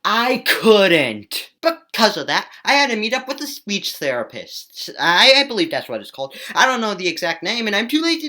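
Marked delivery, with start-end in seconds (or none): none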